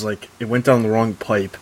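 usual speaking voice

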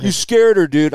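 accusing rider. Retold or imitated.

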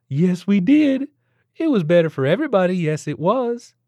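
put-on high, cooing Southern baby talk; sing-song baby talk. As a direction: coo at the baby like a nosy stranger. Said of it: "spoke in that annoying sing-song voice".

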